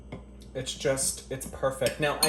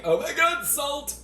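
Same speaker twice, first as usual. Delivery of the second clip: shrieked far higher, hammed-up horror at the salt; mock shock; 13 semitones higher, 3 dB louder, slower than usual. Mocking an imagined taster shocked by salt, squealing. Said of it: "but it's not like".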